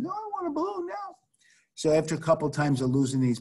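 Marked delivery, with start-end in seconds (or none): 0.0-1.2 put-on high child's demanding whine; imitating a child insisting; whiny little girl. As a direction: whine it like a stubborn toddler. Daughter voiced in story.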